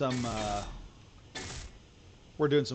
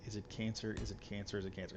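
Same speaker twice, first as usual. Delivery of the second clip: worried repeating; 3 semitones lower, 10 dB quieter than usual. Retold or imitated. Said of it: "we're like".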